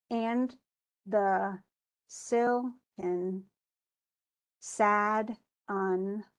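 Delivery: "and the silken" word by word with long pauses; beat by beat. Shown as a demonstration. Teacher scanning the line slowly, one word at a time. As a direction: space every word, stress the first. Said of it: "only with the stress at the beginning".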